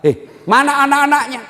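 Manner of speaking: high, loud indignant question; bertanya gusar. Retold or imitated. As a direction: demand loudly to know where they are. Ustaz demands where the children are. (pertanyaan lantang penuh heran)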